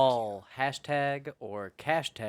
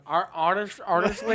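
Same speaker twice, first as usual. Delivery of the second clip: put-on drunk; 7 semitones higher, 6 dB louder than usual. Shown as a demonstration. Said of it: "how I say your name when I'm drunk".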